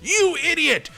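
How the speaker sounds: high-pitched mock yell; imitated yelling viewers. Viewers yelling at the player for missing a card, mock yelling. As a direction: yell it in a high, exasperated put-on voice. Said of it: "some people have been yelling at me".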